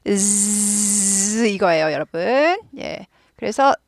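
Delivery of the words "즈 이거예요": 즈 held very slowly as a voiced buzzing z; slow z sound demonstration. Shown as a demonstration. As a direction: stretch the 즈 into a long z buzz. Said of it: "즈 발음".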